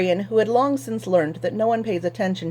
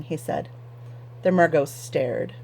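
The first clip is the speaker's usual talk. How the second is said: plain reading voice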